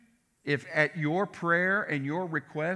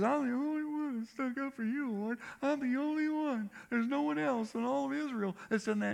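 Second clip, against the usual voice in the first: whiny Elijah; 9 semitones higher than usual. Retold or imitated. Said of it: "and Elijah says".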